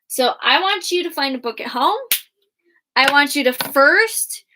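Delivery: normal talking voice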